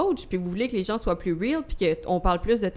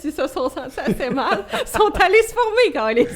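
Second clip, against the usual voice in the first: laughing joke; 7 semitones higher, 6 dB louder than usual. Real, not acted. Joking about podcast bashers.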